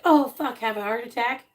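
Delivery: hammed-up freak-out; panicked Garrett. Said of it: "And Garrett's like".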